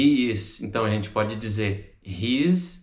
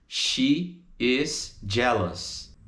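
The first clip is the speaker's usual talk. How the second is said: SHE, IS, JEALOUS, slow and apart; separated full form; much slower than usual. Shown as a demonstration. Teacher models the full form, slowly separated. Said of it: "she is, she's".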